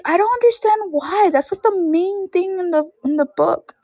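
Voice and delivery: imitating an upset little cousin, high-pitched; frustrated kid. Little cousin protesting the movie skipped the main thing, frustrated. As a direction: mimic a small boy's frustrated protest, voice way up. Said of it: "like, all frustrated".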